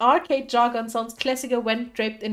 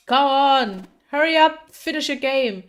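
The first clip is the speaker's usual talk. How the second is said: loud, high, impatient yelling; impatient shout; 5 semitones higher, 6 dB louder than usual. Acted. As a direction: hurry him along at full voice. Impatient customer shouting at attendant.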